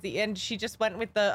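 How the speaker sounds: normal talking voice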